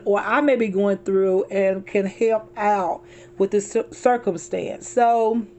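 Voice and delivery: ordinary delivery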